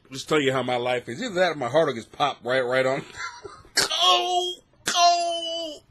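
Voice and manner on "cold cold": raised, drawn-out cold, cold; high mock lament. Comic mourning his own heart popping, raised high. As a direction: sing it out like a mock eulogy.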